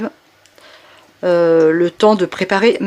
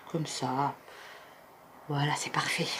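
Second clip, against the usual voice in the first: whisper; 7 semitones lower, 18 dB quieter than usual. Real, not acted. Whispered aside while cutting.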